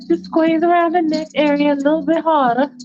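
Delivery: put-on urging girlfriend. Faith urging.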